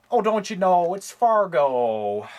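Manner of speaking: put-on drawn-out Minnesota accent, very slow. Collector introduces Fargo in a mock Minnesota accent. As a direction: stretch it out in a thick Minnesota accent.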